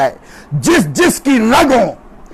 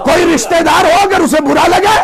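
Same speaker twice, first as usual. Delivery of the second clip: raised, shouted sermon voice; fiery preaching; 5 semitones higher than usual. Acted. Preacher rousing his congregation with a story, shouting.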